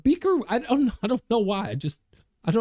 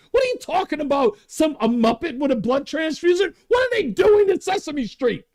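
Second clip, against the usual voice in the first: real exasperation building into a shout; worked-up shouting at Sesame Street; outraged rant; 7 semitones higher than usual. Real, not acted. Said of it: "I'm a little exercised about this".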